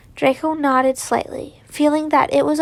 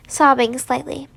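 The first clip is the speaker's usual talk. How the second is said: ordinary delivery, nothing marked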